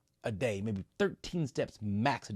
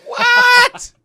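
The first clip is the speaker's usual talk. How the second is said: high, much louder disbelieving yelp; shocked what; 19 dB louder than usual. Retold or imitated. Rower hearing he must row another mile, shocked loud yelp. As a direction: blurt it out in total disbelief.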